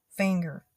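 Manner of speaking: "finger" said fanger, the I opened to a flat A; Southern "finger", fanger; Texas twang finger. Shown as a demonstration. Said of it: "this kinda twang for finger".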